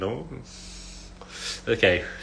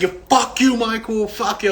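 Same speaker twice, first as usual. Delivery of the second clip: hammed-up rant in a raised pitch; mock angry shouting; 9 semitones higher than usual. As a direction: imitate a friend's angry outburst. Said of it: "like you're mad at somebody".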